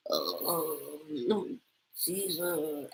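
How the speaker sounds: hungover Russian Ursula, very quiet; barely muster a low, groggy villainess croak; Maria. Acted. Host imitating a hungover woman answering a call, faint and groggy. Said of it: "like, a very hungover Russian Ursula".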